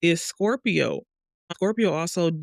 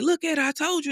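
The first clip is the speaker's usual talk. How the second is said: much higher, eager 'I told you' voice; gushing friend; 11 semitones higher, faster than usual. Retold or imitated. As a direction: hype her up like a proud friend. Friends praising her painting.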